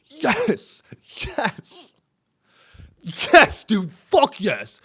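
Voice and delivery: high, strained gloating yes, hammed up; mock celebration. Showing how he is not celebrating the death, mock celebration. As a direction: act out an exultant cheer, high then punched. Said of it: "by going like".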